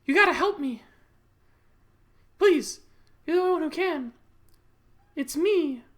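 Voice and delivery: high, loud, hammed-up cry for help; pleading female lead. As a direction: call out desperately in a high voice. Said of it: "She's like".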